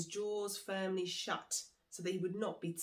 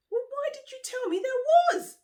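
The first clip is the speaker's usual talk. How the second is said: much higher, louder shriek; 14 dB louder than usual. Acted. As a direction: shriek in betrayed panic. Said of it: "shrieked Monkey".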